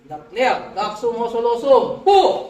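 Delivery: high, loud chanted Latin formulas with shouted Po; exorcism incantation. Real, not acted. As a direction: cast the spirit out at full force.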